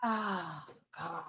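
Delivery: soft, heavy sigh on the words; dreading what must be said. Real, not acted. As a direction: sigh it out quietly, reluctantly. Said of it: "I don't like the sigh".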